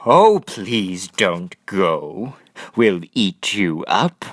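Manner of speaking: big sad beasts begging him to stay; pleading monsters. A narrator's reading. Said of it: "But the wild things cried".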